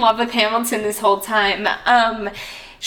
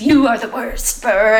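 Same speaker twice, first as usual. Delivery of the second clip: mock French accent, a bit louder on Burr; 3 dB louder than usual. Friend mocking Burr at the wedding, playful French accent. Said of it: "in his French accent".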